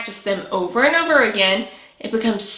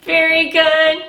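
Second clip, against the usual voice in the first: put-on bright praise for the kids; 5 semitones higher, 4 dB louder than usual. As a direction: cheer them on.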